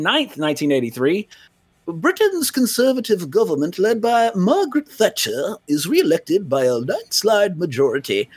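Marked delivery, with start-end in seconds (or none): 1.9-8.3 mock British newsreader accent. Reporting UK news to co-host, mock British accent. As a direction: read it in a hammy British accent. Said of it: "you told me not to do the accent".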